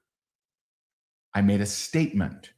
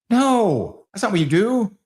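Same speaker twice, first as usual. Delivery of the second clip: loud, high correction; big emphatic "no, not that"; 9 semitones higher, 4 dB louder than usual. Real, not acted. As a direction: shoot the idea down hard. Correcting the amateur loudly.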